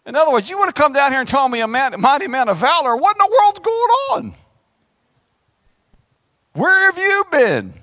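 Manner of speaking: indignant outburst. Acted. Gideon's indignant outburst to God.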